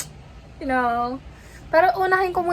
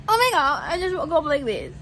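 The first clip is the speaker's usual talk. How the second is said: mock glamour, woke up like this; mock glamorous; 4 semitones higher than usual. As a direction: play a fake-flawless diva. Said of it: "ano bang ina-expect niya".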